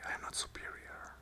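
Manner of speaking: normal talking voice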